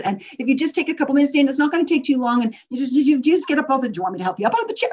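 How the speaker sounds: fast, pushy, high and loud pleading; rambling fast plea. Shown as a demonstration. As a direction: pile on the extra words in a rush. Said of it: "If I had extra words that got in the way".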